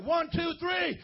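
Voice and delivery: high, loud, rowdy countdown; crazy Friday-night shout. Shown as a demonstration. Pastor firing up the congregation for a rowdy shout. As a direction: hype the crowd like a Friday night party. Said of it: "say it like you young people and you crazy and it's Friday night".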